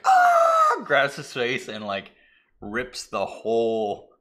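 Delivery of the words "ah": piercing high loud scream. Retold or imitated. Co-anchor screaming as his face melts, loud high scream. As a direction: shriek in agony. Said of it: "He's like".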